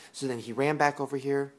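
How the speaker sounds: usual speaking voice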